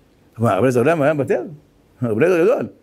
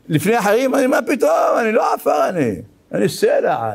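proud boast; 6 semitones higher than usual. Retold or imitated. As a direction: puff up, bragging about standing over others. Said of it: "the man's boast retold".

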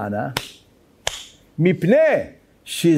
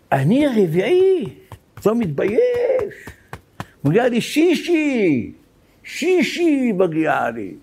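slow, high indignant protest; hammed-up outraged worshipper, drawn out; angry shout; 7 semitones higher, much slower than usual. Retelling the man yelling at the beadle, high slow protest. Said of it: "וצועק על הגבאי צעקות".

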